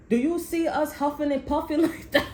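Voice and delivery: words breaking into a laugh. Real, not acted. Telling a friend while cracking up. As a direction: laugh through the question.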